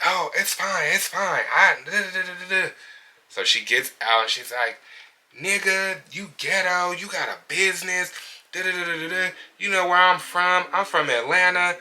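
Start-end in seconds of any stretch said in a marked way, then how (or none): 0.1-2.7 high-pitched mock of an upset client; put-on high voice for a fussy customer; mimicked client. 5.4-11.8 high-pitched mock rant; put-on high voice, mocking her tirade; mimicked angry client.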